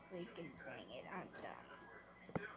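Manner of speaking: defeated whisper under the breath; a muttered curse, barely voiced; frustrated whisper. Real, not acted. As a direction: whisper it, giving up. Frustrated after losing the armor.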